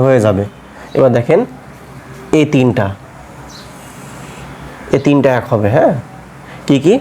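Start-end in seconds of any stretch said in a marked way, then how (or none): none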